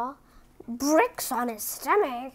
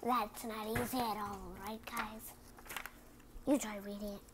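quiet, lower challenge; soft aside; 3 semitones lower, 12 dB quieter, faster than usual. Real, not acted. Reader challenging kids softly. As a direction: hand it over softly.

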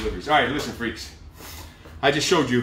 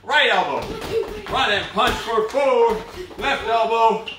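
pumped-up coach calling; 7 semitones higher, slower than usual. Acted. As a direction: fire up the class through the last round. Coach calls burnout round.

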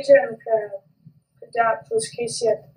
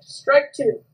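umpire's call; 2 semitones higher, 4 dB louder than usual. Acted. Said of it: "and the umpire said".

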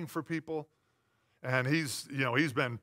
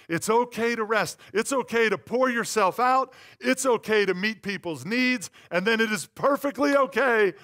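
rising, louder preaching run; 5 semitones higher, 7 dB louder than usual. Acted. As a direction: press each "okay" home like a preacher. Preaching build.

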